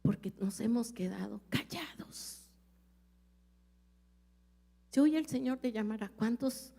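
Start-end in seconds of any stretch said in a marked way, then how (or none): 0.0-2.4 hushed hymn line. Acted. Sorrowful hymn line quoted.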